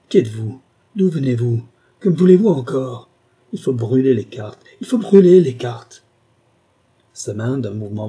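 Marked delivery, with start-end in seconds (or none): 0.1-3.1 loud interrogation building to a shout; escalating, panicked angry questions; terror and fury. A narrator's reading. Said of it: "d'épouvante et de fureur". 3.5-6.0 terrified, furious outburst; frantic fury. A narrator's reading. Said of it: "d'épouvante et de fureur".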